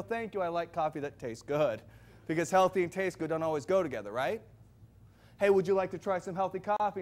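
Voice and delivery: usual speaking voice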